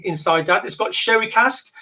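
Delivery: ordinary talk, nothing marked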